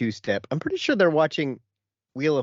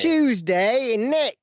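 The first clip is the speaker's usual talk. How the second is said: loud, high Essex squeal; Essex accent; 10 semitones higher, 6 dB louder, slower than usual. Pretend.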